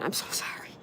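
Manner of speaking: breathy whispered "sorry"; whispered apology. Real, not acted. Apologizing to viewers for kicking the tripod, whispered. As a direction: whisper it, embarrassed at the noise.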